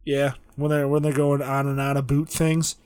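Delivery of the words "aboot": "about" said as Canadian "aboot"; mock Canadian vowel, "aboot" for about; Canadian accent. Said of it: "I like Canadians".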